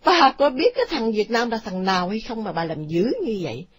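ordinary delivery, nothing marked

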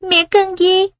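put-on high young boy voice; a child's anxious question. Child asks dying mother.

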